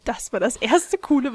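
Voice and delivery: usual speaking voice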